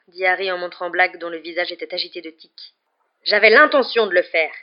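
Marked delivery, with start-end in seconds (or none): 3.2-4.5 loud growl of a line; menacing, raised voice. A narrator's reading. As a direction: growl it out with force. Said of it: "grogna-t-il".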